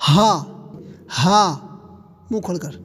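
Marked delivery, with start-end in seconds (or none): 0.1-1.5 ہا pushed out with a strong breath, louder; breathy strong ہا; breathy haa. Shown as a demonstration. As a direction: blow ہا out with air. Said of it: "تیز ہوا کے ساتھ پڑھتے ہیں".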